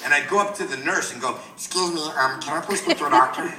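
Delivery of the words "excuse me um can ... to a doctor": lightly squeezed helium-victim voice; polite request in a put-on helium tone. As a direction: speak as if you had a little helium. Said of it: "with the helium voice".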